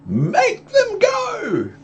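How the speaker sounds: high, slow squealing plea; shrill frightened fish voice; panicky fish. Acted. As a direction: stretch it out way up high. Fish protests.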